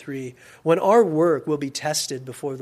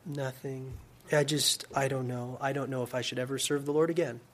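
quiet, lowered, defeated voice; dejected mumbled reply; 2 semitones lower, 9 dB quieter than usual. Acted. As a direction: answer softly, as if all hope has drained away. Defeated pastor answers his wife.

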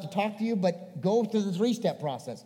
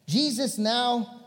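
ordinary delivery

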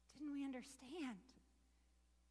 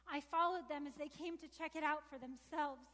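ordinary delivery

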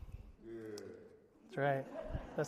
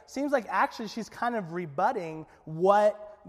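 ordinary talk

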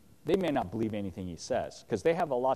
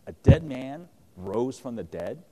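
slow, louder sneer of disbelief; incredulous mockery; 2 semitones higher, 5 dB louder, slower than usual. Festus scoffing at Paul's resurrection claim, slow mocking disbelief. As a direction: scoff at the very idea, drawing it out. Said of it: "what he's saying is".

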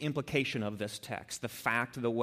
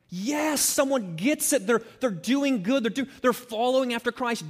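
high, bright burst of delight, slowing at the end; joyful delight; 9 semitones higher, 5 dB louder than usual. Retold or imitated. Preacher voicing joy at a friend's faith, much higher, elated. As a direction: gush with happy admiration.

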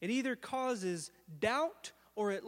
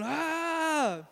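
higher mock scream; retold scream; 6 semitones higher than usual. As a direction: moan out from the ground. Lying on the ground going.

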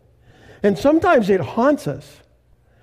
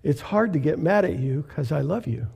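tender reply; 4 semitones lower, 6 dB quieter than usual. Retold or imitated. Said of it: "And I said".

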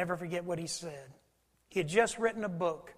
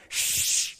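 shushing; 4 dB louder than usual. Retold or imitated. Annoyed passengers hushing.

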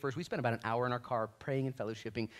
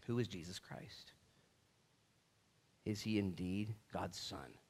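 ordinary talk, nothing marked

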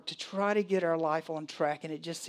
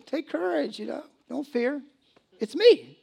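much higher and louder, half laughing; excited Jesus; 8 semitones higher, 6 dB louder than usual. Retold or imitated. Retelling Jesus to the congregation, high and amused. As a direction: lift it up high with a grin. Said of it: "it's like".

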